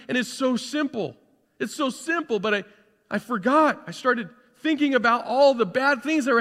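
high and loud, fired-up preacher; rousing pulpit voice lifting the whole room; full-force preaching. Acted. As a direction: preach it out to the back row. Preacher surges back to full force.

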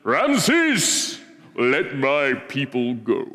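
slow, grand commanding Moses; solemn movie-prophet demand; Charlton Heston as Moses. Retold or imitated. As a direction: proclaim it like an epic film hero. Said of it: "he does the Charlton Heston thing".

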